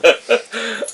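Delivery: loud high laugh; high burst of laughter. Real, not acted. Tannic laughs at Rafim's offer, bright laugh. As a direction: crack up high.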